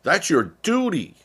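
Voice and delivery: hammed-up stern announcement; mocking authorities. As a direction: lampoon a government order to snitch. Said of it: "And they go".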